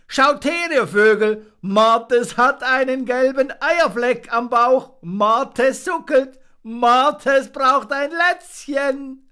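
high, loud, slow singsong jeer; little monkey jeering from the branch; mocking taunt. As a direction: taunt the marten like a cheeky child. Fips mocks the marten loudly.

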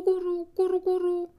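high cooing of the word Guru; dove cooing. Retold or imitated. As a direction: coo Guru like a turtledove. Imitating the turtledoves' cooing.

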